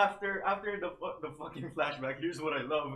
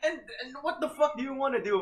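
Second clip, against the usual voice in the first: exasperated rant; 6 semitones higher, 4 dB louder than usual. Retold or imitated. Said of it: "and I'm like".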